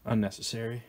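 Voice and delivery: normal talking voice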